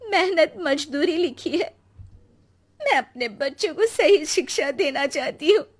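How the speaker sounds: tearful, high pleading to god; crying prayer. Acted. Nisha weeping before Ganesh, pleading tearfully. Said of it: "रोने लगती है".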